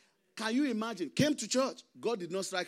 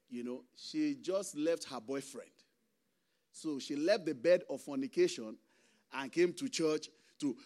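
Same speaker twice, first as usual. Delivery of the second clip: ordinary talk, nothing marked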